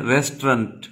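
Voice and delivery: रेस्टोरेंट clipped to rest-rant, no o; रेस्टोरेंट in two syllables, rest-rahnt; correct pronunciation. Shown as a demonstration. Teacher models the correct रेस्टोरेंट. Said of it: "क्या बोलते हैं".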